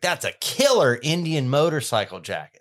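Mike. Retold or imitated. Host imitating Mike from American Pickers, keen impression. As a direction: do an impressed picker admiring a find. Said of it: "that's a really good Mike impression".

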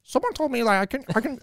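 high, froggy imitation of a guy; Kermit-like voice. Imitating the guy from the layover, in a high Kermit voice. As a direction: squeeze it up high like a Muppet. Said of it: "I can't even do the voice".

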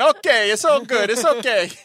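drawn-out, high, loud mock Italian accent; loud Italian caricature, stretched out. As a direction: ham it up as a reassuring Italian. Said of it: "It's like the Italian".

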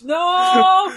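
high, loud, stretched-out protest; exasperated yell. Real, not acted. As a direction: cry out in long protest at the match. Yelling at the match.

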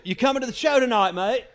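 friendly English invitation. Retold or imitated. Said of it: "And he goes".

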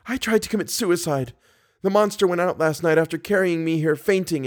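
high-pitched pleading heroine; Christine, frightened captive woman. A narrator's reading. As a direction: warn fearfully in a high female voice.

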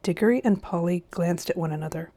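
ordinary delivery, nothing marked

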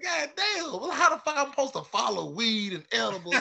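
high, laughing mock exasperation. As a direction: whine it high through laughter. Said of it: "I'm like".

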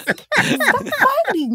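drawn-out high shout. Real, not acted. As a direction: call out high and slow to stop them. Friend pulled into playfight.

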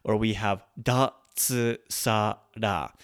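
pa, su, ko, n spaced out slowly; pa su ko n one beat each; split into mora. Shown as a demonstration. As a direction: split pasukon into four beats. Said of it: "four mora".

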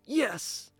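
a high triumphant yes; excited cheer. Acted. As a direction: pump your fist with joy. Teen hears of trip.

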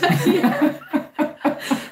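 high burst of laughter; high relieved laughter. Real, not acted. Laughter after the joke.

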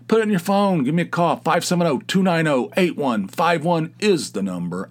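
high, loud, hyped-up announcer; pumped radio promo; excited pitch. Acted. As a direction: sell the phone number with energy. Pitching the call-in number.

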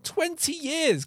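high, drawn-out disbelief; incredulous exclamation. Real, not acted. Podcaster reacting to how old the film is, incredulous. Shocked at film's age.

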